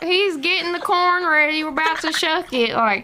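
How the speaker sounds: mocking the media's backwoods Southern twang. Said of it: "overplayed in the media".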